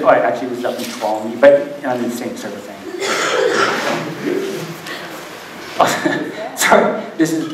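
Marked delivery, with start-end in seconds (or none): 2.9-5.0 soft breathy laugh. Real, not acted. Laughing at own mix-up.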